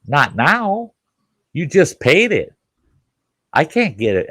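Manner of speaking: a judge throwing up his hands; exasperated judge. Retold or imitated. Judge telling defendant it's too late, high and exasperated.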